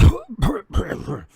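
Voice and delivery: choking cough from the smoke; acted cough. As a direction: hack on the smoke.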